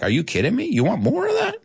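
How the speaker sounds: playfully outraged, voice climbing high; are you kidding me; incredulous. Retold or imitated. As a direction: pitch shooting up in mock disbelief. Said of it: "And I was like".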